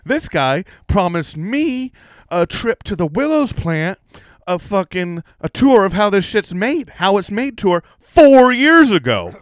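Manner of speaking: rising to a loud complaint; building indignant demand. Retold or imitated. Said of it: "I was like".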